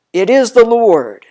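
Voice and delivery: loud, high, joyful cry; excited exclamation. Acted. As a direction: burst out in recognition. Disciple recognizes Jesus, exclaims.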